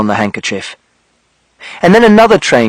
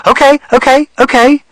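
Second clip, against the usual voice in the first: soothing; 11 semitones higher than usual. Acted. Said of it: "she said".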